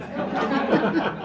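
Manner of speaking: sheepish high laugh at her own accent; real laugh after a bad accent; embarrassed laugh. Real, not acted. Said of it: "That's a horrible Russian accent".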